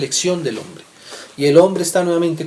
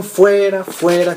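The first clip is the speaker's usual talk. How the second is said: a louder, higher crowd cry of Fuera; shouted crowd cry; 5 semitones higher, 6 dB louder, much slower than usual. A narrator's reading. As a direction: call out the crowd's demand. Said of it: "Pero ellos gritaron". (grito de la multitud, más fuerte y agudo)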